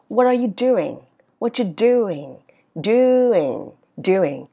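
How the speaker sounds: drawn-out "doing" sliding down; falling question tune. Shown as a demonstration. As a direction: stretch "doing" and let it fall. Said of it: "ngữ điệu đi xuống". ("doing" xuống giọng, kéo dài)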